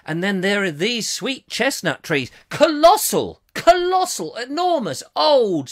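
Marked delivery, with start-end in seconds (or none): 2.5-5.7 high, loud colossal; awed exclaim. Real, not acted. Describing giant chestnut trees to viewers with high excited awe. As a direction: marvel at the size.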